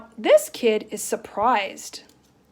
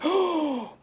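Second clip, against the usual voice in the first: surprised gasp; 5 semitones higher than usual. Shown as a demonstration. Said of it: "it's like".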